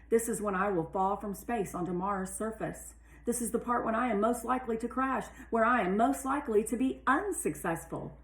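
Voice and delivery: ordinary delivery, nothing marked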